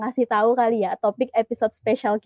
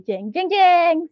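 drawn-out, higher mock fanfare; dramatic reveal jingle; 5 semitones higher, much slower than usual. Host introducing the special guest to listeners, mock fanfare. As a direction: announce the reveal with a slow "jeng, jeng, jeng".

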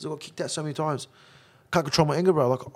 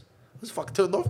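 ordinary talk, nothing marked

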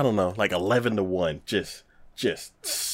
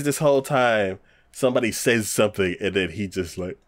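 normal talking voice

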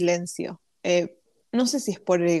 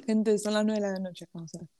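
mouth full; 6 dB quieter than usual. Real, not acted. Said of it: "Perdón, estoy comiendo".

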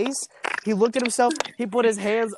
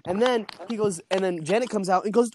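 normal talking voice

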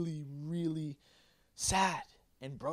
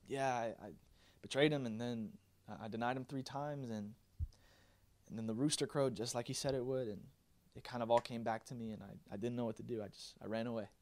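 low, soft, halting admission; acted, understated remorse; subdued confession; 5 semitones lower, 7 dB quieter than usual. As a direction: confess quietly, as if ashamed. Imagined confession of Peter to disciples.

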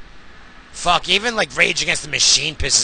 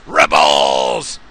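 "Rebels!" barked much lower and loud; shouted "Rebels!"; 14 semitones lower, 3 dB louder than usual. Shown as a demonstration. Ranting about punks, he yells "Rebels!" low and loud. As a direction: punch out "Rebels!" like a slogan.

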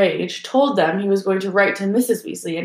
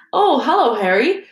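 cheerful greeting; 6 semitones higher, 4 dB louder than usual. A narrator's reading.

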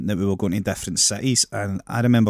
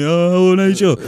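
high, loud sing-song hello; mock cheery greeting; 10 semitones higher, 7 dB louder than usual. Traveller mocks greeting the same Nigel again, high and sing-song. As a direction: greet him with fake delight.

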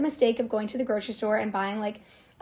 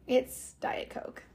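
hushed confession; 7 dB quieter, much slower than usual. Real, not acted. Confessing guilty pleasure, hushed.

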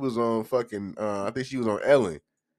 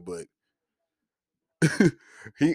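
ordinary talk, nothing marked

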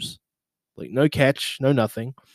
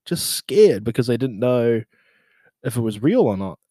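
ordinary talk

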